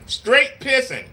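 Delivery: slow, weighty "Straight pissing"; emphatic drawn out. Real, not acted. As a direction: lean on every word of "Straight pissing". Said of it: "slow emphatic punch".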